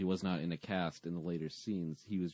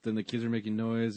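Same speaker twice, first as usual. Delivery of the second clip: ordinary delivery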